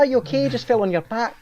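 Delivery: high Scottish-flavoured "Are you okay?"; teasing Scottish impression; mock Scottish. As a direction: do Louise with a slipping Scottish accent. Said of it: "Oh, that's Scottish".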